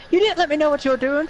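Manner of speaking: protesting fast in a high voice. Real, not acted. Friend protests that he was not told about the stream, high and fast. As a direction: whine it out quickly and high.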